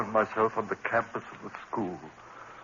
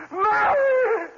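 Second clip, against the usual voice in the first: high, loud wail of her name; husband crying out over her body; anguished scream; 5 dB louder than usual. Acted. Daryl realizes she is dead.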